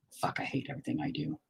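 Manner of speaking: frustrated whisper under breath. Real, not acted. Painter whispering frustration at his own work. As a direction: hiss it to yourself in frustration.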